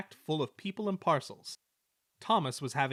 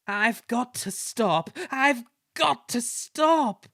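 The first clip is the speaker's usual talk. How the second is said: slow, higher and louder, building panic; groaning panic; 10 semitones higher, 8 dB louder, slower than usual. Acted. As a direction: strain desperately to stop. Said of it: "groaned James".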